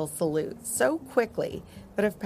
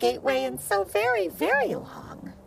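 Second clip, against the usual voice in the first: little dragon voice; 5 semitones higher than usual. Acted.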